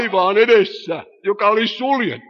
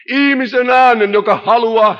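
louder, higher proclamation of the human voice; emphatic call; 4 semitones higher, 6 dB louder than usual. Acted. Preacher to congregation, crying out the human voice. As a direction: ring it out to the back pews.

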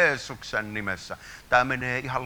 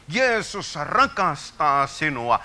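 high, loud and slow, voice strained; fervent slow cry; 5 semitones higher, 6 dB louder, slower than usual. Real, not acted. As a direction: cry it out slowly. Climax of the prayer. (hidas, korkea huudahdus)

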